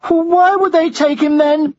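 high, slow plaintive question; distressed boy. Acted. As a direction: ask as an upset young child. Distressed boy questions his mother.

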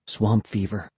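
soft, low-key murmur. A narrator's reading. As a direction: murmur it under the breath. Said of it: "she murmured".

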